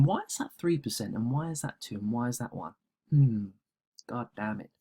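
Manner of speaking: quiet irritated mutter to himself; frustrated muttering. Real, not acted. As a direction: grumble under your breath at the screen.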